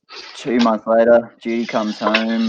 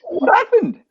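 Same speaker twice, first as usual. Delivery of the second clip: very high falsetto squeak of a shocked wife; shrill wife voice; slower than usual. Acted. Joke teller voicing the shocked wife in falsetto. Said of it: "She goes".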